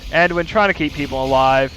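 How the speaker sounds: fast, rising match commentary; live play-by-play. Acted. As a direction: call the action as it happens.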